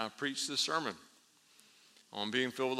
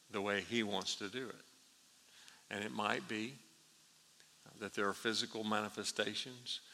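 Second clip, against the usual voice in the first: ordinary talk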